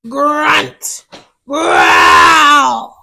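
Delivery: growling grunt. Acted. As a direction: snarl it out like a beast.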